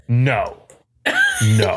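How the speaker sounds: lightly imitated pop-diva refusal; a hint of song-quoting voice. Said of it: "in [name]'s America Has a Problem voice".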